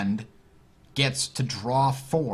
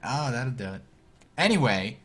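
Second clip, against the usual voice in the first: ordinary talk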